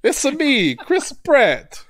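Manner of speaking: high, bouncy Mario voice; Mario impression. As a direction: do a cartoon Italian plumber. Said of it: "Not my Mario".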